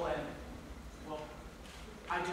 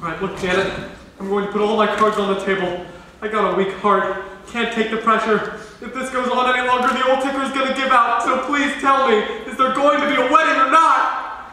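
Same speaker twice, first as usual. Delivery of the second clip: bellowed, frantic, higher as it builds; loud pleading demand; 4 semitones higher, 11 dB louder than usual. Acted. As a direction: shout it out in a panic for his heart. Father figure demanding answer, bad heart.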